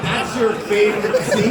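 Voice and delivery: retold astonishment with laughter in it; amused disbelief. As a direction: question him in mock disbelief. Incredulous reply to Tommy, acted out.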